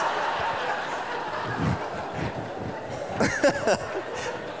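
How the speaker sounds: high breathless laugh; preacher cracking up at his joke; helpless laughter. Real, not acted. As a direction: break up laughing at the punchline. Laughing at own story.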